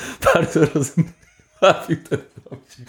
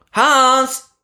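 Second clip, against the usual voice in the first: calling out; 11 semitones higher than usual. Acted. Soldiers call out across trenches.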